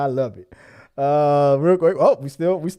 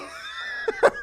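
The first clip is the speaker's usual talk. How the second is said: laughing; 5 dB quieter than usual. Real, not acted. Laughing after mom impression.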